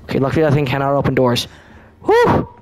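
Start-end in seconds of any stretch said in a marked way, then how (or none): 2.0-2.5 relieved whew. Real, not acted. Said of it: "That got me bad".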